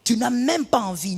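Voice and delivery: ordinary delivery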